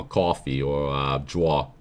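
"coffee" and "draw" low, with rounded New Jersey "aw" vowels; Jersey pronunciation. Shown as a demonstration. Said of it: "common words that everyone says from New Jersey".

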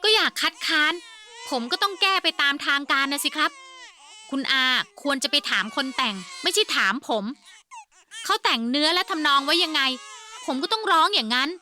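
exasperated defence. Acted. Defensive argument to uncle.

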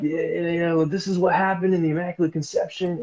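high, loud, eager explaining; eager rambling. Retold or imitated. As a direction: ramble on excitedly like an overkeen explainer. Acts out his own eager rambling.

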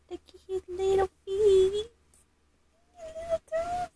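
high, soft doting coo. Real, not acted. Mom cooing at the new doll's toes. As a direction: coo over the tiny toes.

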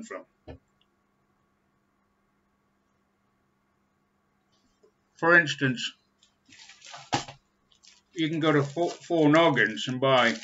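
talking around pipe stem; pipe in mouth. Real, not acted. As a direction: teeth clenched on pipe. Seen 0: Pipe clenched in teeth until about 6s, then held in hand.

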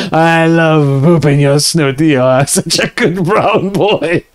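slow, stretched mock French accent; bad French accent. Streamer repeating his line to the dog in bad French accent. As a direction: coo it in hammy bad French. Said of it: "Bad French accent".

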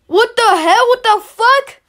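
loud disbelief; shocked. Real, not acted. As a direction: exclaim in shock. Disbelief at the video.

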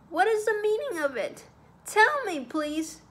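high-pitched, agitated pleading; shocked demand. A narrator's reading. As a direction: demand an explanation in alarm. Shocked man demands an explanation.

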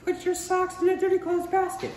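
put-on sing-song mother telling kids off; a high-pitched mom giving orders; mom's voice.

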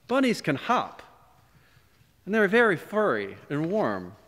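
high, wistful little bunny thought; a lightly voiced bunny's hopeful thought. A narrator's reading. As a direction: muse to yourself like a small hopeful rabbit. Bunny musing to himself.

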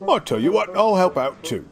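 eager offer. Acted. Richard eagerly offers help.

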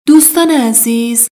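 ordinary delivery

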